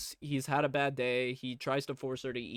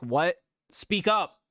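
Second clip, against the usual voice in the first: raised, impatient boss voice; loud demand; 3 semitones higher, 7 dB louder, slower than usual. Acted. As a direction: call out louder as if you can't hear him. Boss demanding shy man speak up.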